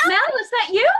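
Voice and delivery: high, loud, surprised greeting; acted princess surprise, pitched high; delighted surprise. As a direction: call out as if spotting a friend. Elsa arrives, greeting the host.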